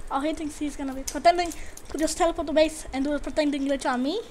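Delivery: ordinary talk, nothing marked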